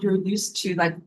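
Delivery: ordinary delivery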